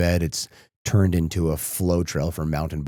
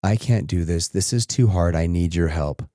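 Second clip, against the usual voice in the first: ordinary talk, nothing marked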